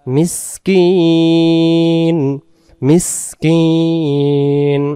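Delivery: মিসকিন with the vowel stretched long; recitation-style stretched মিসকিন; drawn-out miskin. Shown as a demonstration. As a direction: pull মিসকিন out slowly, holding each vowel. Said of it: "এক আলিফ টান".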